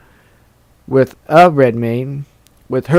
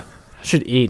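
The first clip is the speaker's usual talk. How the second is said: ordinary talk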